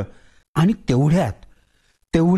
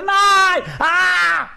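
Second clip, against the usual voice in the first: much higher, louder scream of pain; screaming boy; 3 dB louder, much slower than usual. Acted. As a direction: scream in agony. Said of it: "किंकाळ्या फोडू लागलं".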